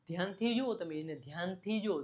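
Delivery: normal talking voice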